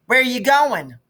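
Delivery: put-on high, loud little girl asking; a small girl peeking out of bed; little sister's voice. Little sister Maybelle wakes and asks.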